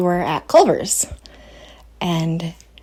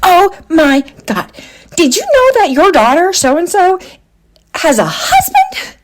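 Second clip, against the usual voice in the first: gossiping on the phone; 11 semitones higher, 3 dB louder than usual. Retold or imitated. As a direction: imitate the child playing a gossiping mom. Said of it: "she totally pretends to get on the phone and she goes".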